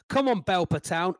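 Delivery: ordinary talk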